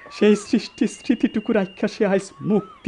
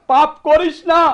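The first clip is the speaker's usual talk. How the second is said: higher, pressing warning; urgent plea; 9 semitones higher than usual. Acted. Son warning the servant, urgent and raised. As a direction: drive the warning home.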